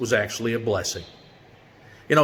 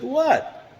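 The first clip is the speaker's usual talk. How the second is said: confused what. Retold or imitated. Confused lieutenant asks what's wrong.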